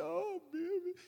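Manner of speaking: high, drawn-out whimper; hammed-up pitiful cry; mock pleading. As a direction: moan it for sympathy. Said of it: "when you come in and say".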